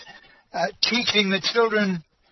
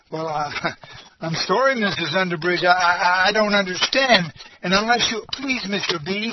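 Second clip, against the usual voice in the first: usual speaking voice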